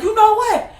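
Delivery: put-on high, loud child's declaration; high defiant kid voice. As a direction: announce it like a kid storming off. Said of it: "I was like".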